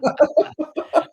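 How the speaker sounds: high-pitched burst of laughter. Real, not acted. Laughing after the joke.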